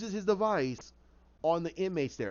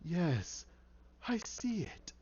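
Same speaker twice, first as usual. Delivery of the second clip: gloating villain; 4 semitones lower, much slower than usual. Acted. Hatter watching Crane's mind break, gloating. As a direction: relish it almost in a whisper.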